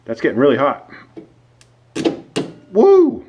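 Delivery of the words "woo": sudden high excited woo; excited whoop. Real, not acted. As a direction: yelp it out, startled and thrilled. Wire getting hot.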